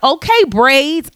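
drawn-out, high-pitched playful hype; teasing compliment. Retold or imitated. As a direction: stretch it out high, like ribbing a friend.

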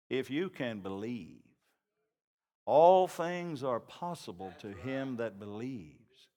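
low, soft and slow; calm Jesus. Acted. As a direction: answer gently with quiet authority. Jesus answers the father.

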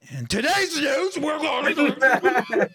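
high, shouted news announcer; loud newscaster. Retold or imitated. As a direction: boom out the news, laughing. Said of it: "Deontay's like".

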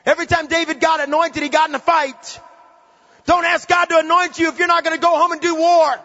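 high, fervent preacher voice building to a shout; fired-up preaching. Real, not acted. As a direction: drive it home to the congregation. Preaching at full force.

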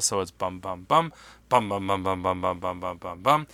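vocal shofar imitation, long then quick blasts; staccato. Shown as a demonstration. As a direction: hum the combined shofar call. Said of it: "we combine the two shavarim and teruah".

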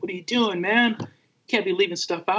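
high voice of a scolding friend; mock scolding. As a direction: mimic a buddy chewing him out.

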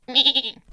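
loud bright burst of laughter; excited laugh. Acted. Girl laughing at her own hero dream. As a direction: laugh with delight.

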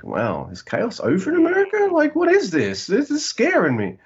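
high-pitched comic panic; mock alarm. Wrestling fan reacting to a crowd going nuts for war drums, mock alarm. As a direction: squeal it in exaggerated alarm. Acting out his alarm at chaos.

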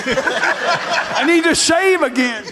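high, laughing quote of a bored churchgoer; giggling about sitting there forever; laughing mock complaint. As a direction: laugh through the gripe. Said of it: "It's like".

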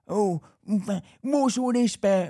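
a fussy neighbour griping; mock neighbour. Neighbour's complaint acted out.

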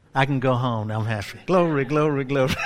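normal talking voice

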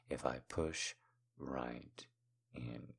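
low, intimate hush with pauses; hushed teasing. Acted. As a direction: let each word sink almost to nothing. Lingering, teasing repeat of phrase.

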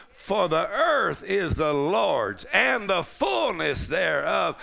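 loud, high, slow shouted declaration; shouting faith declaration, slow and loud; full-force preaching. Acted. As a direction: preach it to the rafters, building to a shout.

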